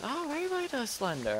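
squeaky mocking imitation of everybody; mocking high voice. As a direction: parrot them in a silly high voice. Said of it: "Everybody says".

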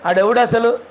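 ordinary talk

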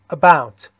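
About with a brief "uh" at the start, projected; loud, clear British "About" starting on a schwa; short schwa. Shown as a demonstration. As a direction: say "About" with a short first vowel. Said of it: "یه صدای ا کوتاه".

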